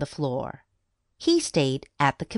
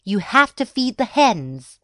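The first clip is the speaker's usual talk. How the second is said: insistent mom; 6 semitones higher, 5 dB louder than usual. A narrator's reading. Mom orders Jake to feed hens.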